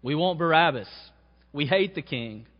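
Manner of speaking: preacher voicing the crowd yelling against the king; crowd shout. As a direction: shout it like an angry mob. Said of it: "shouted out".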